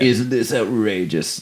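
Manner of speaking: slow, quiet mock pitch line; mock hacky movie voice. Mocking hacky comedies, a slow cheesy pitch. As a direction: sell it like a cheesy trailer. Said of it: "kind of like".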